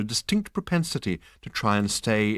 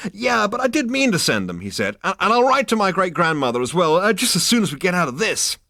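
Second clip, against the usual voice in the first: quick, much higher, flustered excuses; nervous fast Zaphod; 10 semitones higher, 6 dB louder, faster than usual. A narrator's reading. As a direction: babble the promise nervously and fast.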